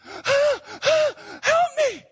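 desperate gasping shout for help; frantic screaming. Acted. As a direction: cry out wildly for help. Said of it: "and now screaming".

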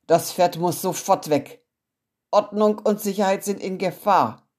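loud, stern order; stern headmaster. Acted. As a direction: bark the order like a strict principal. Headmaster orders horse away. (Direktor befiehlt der Klasse laut und streng)

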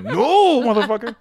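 high, loud drawn-out refusal with laughter; mock defiant horse. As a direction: blurt it out as a stubborn horse.

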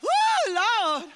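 high, loud whoop of overwhelmed feeling; real emotional cry. Real, not acted. Speaker overcome while recalling her conversion, crying out. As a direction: let the emotion burst out. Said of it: "I'm gonna try not to cry".

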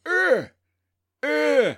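high, loud imitated animal grunts; mating grunts.

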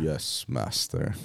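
low, slow servile reply; bow and drawl it. Retold or imitated. Echoing quote.

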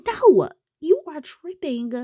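hammed-up no, you are tripping; incredulous refusal. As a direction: play her as shocked and unwilling. Winona refuses, retold scene.

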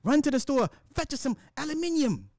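high, put-on British command; mock British accent. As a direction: send someone off as a high-voiced pretend Brit. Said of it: "imagine me telling the wife".

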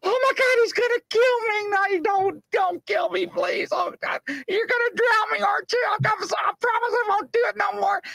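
very high mock wail; hammed-up begging for his life; terrified pleading. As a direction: imitate a panicked man shrieking for mercy. Panhandler fears he will be killed.

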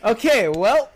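laughing mock farewell. Telling friends his Dachau parting line, laughing. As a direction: blurt out the goodbye through laughter.

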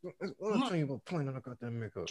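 low hushed parent warning; a stern parent, voice kept low; scolding parent. Retold or imitated. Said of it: "like how a parent be with the kid".

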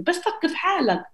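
ordinary delivery

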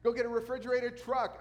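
hammed-up whoop to his father about the catch; mock excited scream. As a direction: yell it like you just struck it rich. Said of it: "What do you think he screamed?".

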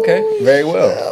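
mock calm. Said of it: "It's like".

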